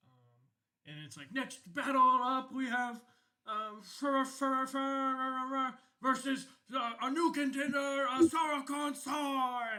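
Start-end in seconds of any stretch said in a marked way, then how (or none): 1.3-9.8 high, loud announcer call, slowing on the last name; arena announcer naming the fighters, high and loud. Acted. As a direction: boom it out to the whole arena. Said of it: "you hear, like, an announcement".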